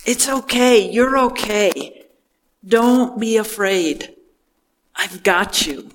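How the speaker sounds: tender reassurance. Retold or imitated. Jesus catching sinking Peter, gentle and soothing. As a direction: hold him up and soothe him. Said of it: "the feeling is the same".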